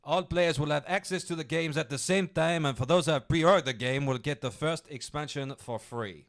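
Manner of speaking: loud, hammed-up French accent. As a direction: ham up a French executive's statement. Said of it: "I did it in a French accent".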